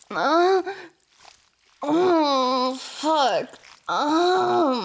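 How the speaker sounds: loud, high moaning cries; climax moans. Acted. Roommate reaching climax, loud moaning cries. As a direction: let the cries break out, peaking.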